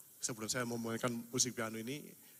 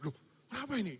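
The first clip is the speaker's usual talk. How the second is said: higher, astonished "what's happening"; startled surprise; 9 semitones higher, faster than usual. Retold or imitated. At the hospital bed, seeing the hand move, startled cry. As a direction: blurt it out in shock. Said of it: "Saya kan kaget".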